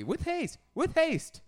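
high, loud theatrical plea; hammed-up, squeaking command; mock urgency. Begging co-host to burn the album, mock urgency.

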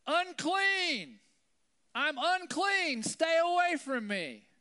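high, loud, slow drawn-out yell; yelled warning. Retold or imitated. Leper warns passersby to keep away, yelling high and loud. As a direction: warn everyone off from a distance. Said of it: "you had to yell out".

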